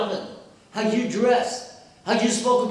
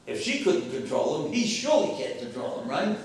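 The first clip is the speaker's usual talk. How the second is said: ordinary talk, nothing marked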